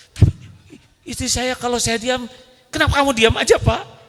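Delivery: high, whiny nagging wife voice; mock nagging wife. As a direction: needle the husband for staying silent. Wife voiced complaining to husband.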